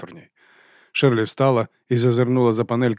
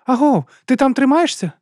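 high, raised, anxious check-in; worried; 6 dB louder than usual. A narrator's reading. Juliette asking Sherly if she is holding up, worried. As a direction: call out with worry for a friend. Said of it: "Хвилювання в голосі подруги".

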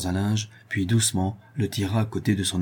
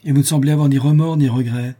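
higher, louder indignant reproach; outraged woman rebuking her lover; reproachful; 6 semitones higher, 8 dB louder than usual. Acted. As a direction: scold them for feeling nothing.

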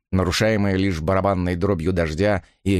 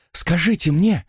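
high plea with the voice giving way; voice breaking; 8 semitones higher than usual. A narrator's reading. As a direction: plead until the voice cracks. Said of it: "её голос сорвался". (срывающимся голосом)

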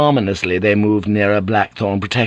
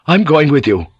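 loud, higher defiant announcement; truculent; 6 semitones higher, 6 dB louder than usual. Acted. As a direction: challenge the captain belligerently. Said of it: "Jan Roper said truculently".

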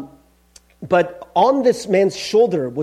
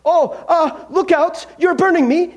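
high British yelp of alarm; alarmed ghost; 11 semitones higher, 5 dB louder than usual. A narrator's reading. Ghost to the approaching angel, alarmed high cry. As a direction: cry out as if being burned.

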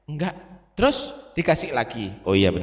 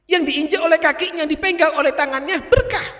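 soaring high, shouted praise of the saints; preaching at full force; 12 semitones higher, 5 dB louder than usual. Acted. Preacher to the congregation, shouting each blessing.

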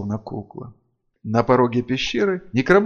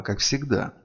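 usual speaking voice

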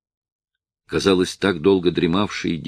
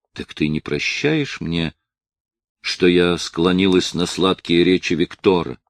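usual speaking voice

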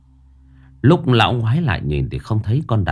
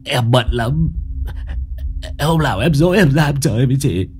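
stammering plea. A narrator's reading. Old man stammers, pleading with the ghost. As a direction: babble out a frightened promise. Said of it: "miệng lắp bắp".

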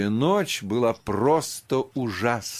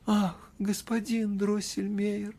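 put-on sobbing girl; 11 semitones higher than usual. A narrator's reading. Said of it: "рыдая".

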